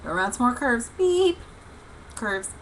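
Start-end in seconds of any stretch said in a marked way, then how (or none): 1.0-1.4 high-pitched horn toot; imitated horn beep. Driver honking round more curves, imitated horn beep. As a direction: squeak out a car horn. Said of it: "honking the horn".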